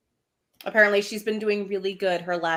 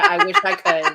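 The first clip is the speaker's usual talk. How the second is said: amused, laughing; 11 semitones higher, 6 dB louder than usual. Real, not acted. Joking about copying Magda's accent.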